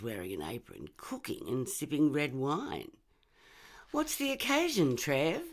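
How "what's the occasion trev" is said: cheerful mum. Acted. Mum's cheerful question.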